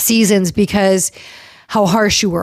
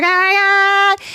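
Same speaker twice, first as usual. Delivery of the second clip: high, drawn-out fangirl gush; starstruck excitement; 10 semitones higher, slower than usual. Retold or imitated. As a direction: imitate an excited fan, pitched up and stretched. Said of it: "love how excited she got up".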